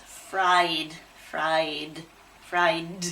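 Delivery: low, careful "Fried" with a voiced ending. Shown as a demonstration. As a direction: demonstrate "Fried", releasing the final d. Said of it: "Feel the d".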